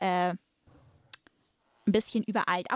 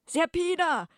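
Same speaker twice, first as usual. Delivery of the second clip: much higher, louder urgent call of her name; 11 semitones higher, 4 dB louder, slower than usual. Acted. Captain warns the bound woman, high urgent call. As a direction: cry out Serpina from across the deck.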